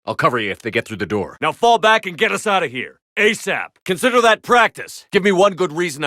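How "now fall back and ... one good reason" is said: high, loud commands; battle shout; shouted orders. Acted. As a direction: yell to the team over gunfire.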